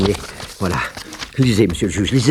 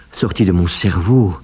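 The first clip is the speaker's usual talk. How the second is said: usual speaking voice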